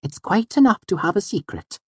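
high, gently chiding voice; Auri. A narrator's reading. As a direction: chide him gently in a dainty voice. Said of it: "she chided me gently".

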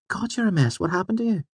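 very high, light female character voice; high-pitched feminine dismay; startled young woman. A narrator's reading. Narrator voices Fela's startled concern.

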